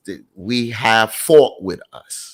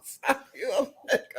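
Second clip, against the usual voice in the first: laughing; 8 dB quieter than usual. Real, not acted. Laughing repeat of joke.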